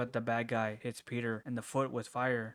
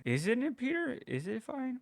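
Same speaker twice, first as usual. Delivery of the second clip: much higher, sing-song mocking voice; mocking tease; 12 semitones higher than usual. [name] teasing Peter about his job, high and mocking. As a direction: needle him in a high teasing voice.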